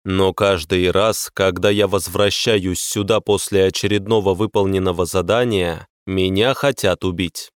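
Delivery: ordinary delivery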